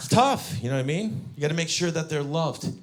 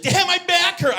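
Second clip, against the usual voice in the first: put-on much higher, loud kid's complaint; daughter complaining; 8 dB louder than usual. Six-year-old daughter at breakfast, high loud whine. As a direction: squeal it like a sore little girl. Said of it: "She's like".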